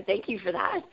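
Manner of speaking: ordinary talk, nothing marked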